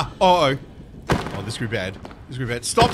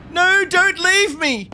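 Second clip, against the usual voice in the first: mock plea; 11 semitones higher, 3 dB louder, slower than usual. Real, not acted. Pleading with a mob in game.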